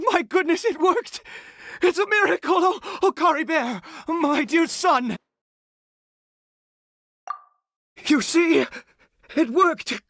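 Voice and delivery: high, ecstatic cries of joy; can't believe his son is back; overjoyed father. Acted. Father sees son revived.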